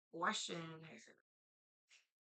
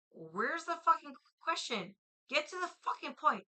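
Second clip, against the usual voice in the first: louder, higher, irritated outburst; real impatience; 6 semitones higher, 7 dB louder than usual. Real, not acted. Streamer fed up with another statement instead of a question, impatient. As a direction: demand it, fed up and loud. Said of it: "I'm very impatient".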